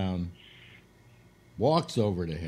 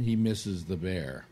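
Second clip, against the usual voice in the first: normal talking voice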